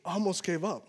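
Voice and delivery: soft, low confession; confessing. Acted. David admitting to God he almost quit, soft and low. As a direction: let it drop to almost nothing. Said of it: "As for me".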